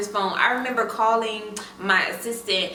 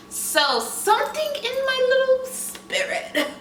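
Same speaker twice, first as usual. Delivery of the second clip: high slow aside. Acted. High drawn-out inner voice.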